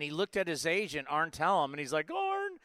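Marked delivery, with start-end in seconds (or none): 2.1-2.5 high, whiny mock sob of a crying player. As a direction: imitate him pleading through tears, pitched way up. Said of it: "and he's crying".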